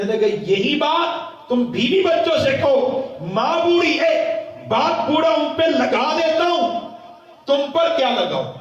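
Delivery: loud, high-pitched impassioned sermon; full-force preaching. Acted.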